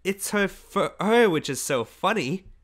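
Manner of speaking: high, drawn-out amusement; giggly. A narrator's reading. Girl mocking the old woman's fur to the boy, giggly. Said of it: "giggled the girl".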